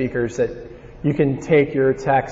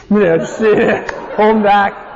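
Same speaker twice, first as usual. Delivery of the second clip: embarrassed laugh, higher and louder; 6 semitones higher, 5 dB louder than usual. Real, not acted. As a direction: laugh it off sheepishly. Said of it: "you know what I'm talking about".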